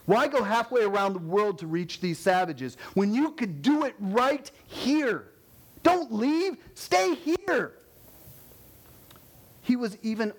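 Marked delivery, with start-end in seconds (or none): 0.1-7.7 rising to a high, pleading protest; pleading objectors. Retold or imitated. Friends urging the missionary to stay home, mock high pleading. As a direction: beg him to stay, climbing higher. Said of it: "They argued that he would be leaving".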